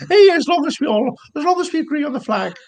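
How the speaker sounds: high-pitched mock happy drunk. Mimicking boozy streamers who agree on the flag, happy drunk. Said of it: "always pissed on the stream, and then they're really happy".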